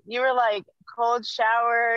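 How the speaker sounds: normal talking voice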